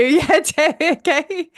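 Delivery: a real laugh running through the name. Real, not acted. Host laughing as she calls on Debbie to share.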